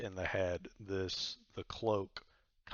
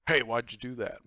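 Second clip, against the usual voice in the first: loud, higher indignant cloak voice; hurt protest; 4 semitones higher, 11 dB louder than usual. Acted. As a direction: protest a punch you just took. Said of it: "the voice kind of sounds like".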